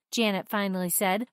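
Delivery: plain reading voice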